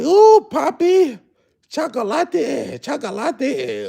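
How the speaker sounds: very high, drawn-out falsetto swoon; mock admiring Latinas. Streamer imitating Latinas admiring his cowboy look, mock falsetto swoon. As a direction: imitate women cooing over you, slowly. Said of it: "them Latinas, they like".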